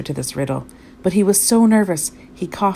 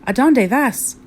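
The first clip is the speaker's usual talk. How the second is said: raised, higher call; 6 semitones higher, 4 dB louder, slower than usual. A narrator's reading. Kitten hails his Chihuahua amigos, calling out. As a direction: call it out in Spanish. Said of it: "called out Scipito".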